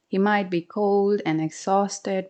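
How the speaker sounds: plain reading voice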